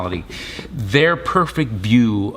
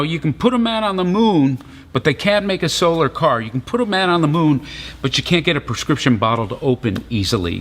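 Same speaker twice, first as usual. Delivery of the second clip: higher, quick comic rant; Seinfeld-style bit; 5 semitones higher, faster than usual. Retold or imitated. Preacher mimicking a comedian's joke, high quick comic rant. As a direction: riff like a stand-up comic. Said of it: "that old Seinfeld joke".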